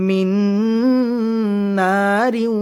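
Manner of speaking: মিন নারিউ read joined, without stopping on নার. Shown as a demonstration. Teacher showing students how the joined reading sounds, নারিউ linked. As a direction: link নার straight into the next word. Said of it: "যদি আমরা মিলিয়ে পড়ি তখন এরকম হবে".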